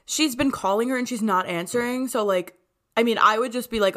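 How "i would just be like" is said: normal talking voice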